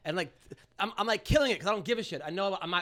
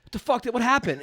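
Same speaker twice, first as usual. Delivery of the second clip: loud, higher mock outrage; exasperated agent; 6 semitones higher, 7 dB louder than usual. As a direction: demand an explanation as the agent, half laughing. Agent confronts him on the phone.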